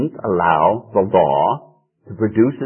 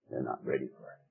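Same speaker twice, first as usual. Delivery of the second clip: low and soft; 10 semitones lower, 19 dB quieter, faster than usual. Shown as a demonstration. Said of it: "repeated for emphasis".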